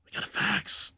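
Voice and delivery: soft whispered retort; whispering. Real, not acted. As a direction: hush it out under your breath. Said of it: "Why are we whispering?".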